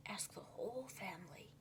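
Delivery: hushed conspiratorial whisper. A narrator's reading. Uncle Vernon tips off Desmond in secret, whispering. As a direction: breathe it like a secret tip. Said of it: "he whispered".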